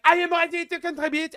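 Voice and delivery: high, loud hammed-up French accent; mock French accent. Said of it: "Macron's like".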